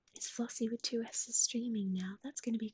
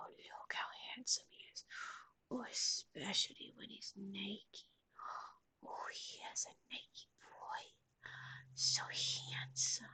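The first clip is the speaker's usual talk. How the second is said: soft, breathy fawning over a pet; hushed cooing; 4 semitones lower, 5 dB quieter than usual. Real, not acted. Streamer fawns over the handsome naked dog, hushed cooing. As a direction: coo it softly, half whispered.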